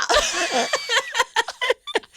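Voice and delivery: high, sustained burst of laughter. Real, not acted. Friend cracks up at her own story, high laughter.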